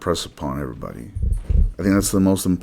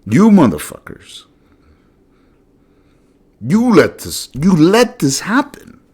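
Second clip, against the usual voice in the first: angry outburst; 9 semitones higher, 6 dB louder than usual. Acted. Host voicing what people should tell leaders, furious accusing yell. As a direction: hurl the accusation at them. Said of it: "Obviously, you can hear it in my voice".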